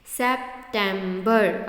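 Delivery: September broken slowly into three beats, "sep-tem-ber"; split into syllables. Shown as a demonstration. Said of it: "वो कितने part में टूटता". (सितंबर को तीन हिस्सों में तोड़कर, धीरे)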